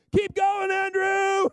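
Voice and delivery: high, loud cheering call to Andrew; excited shout. Retold or imitated. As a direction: yell it out, thrilled. Jesus calls out while multiplying.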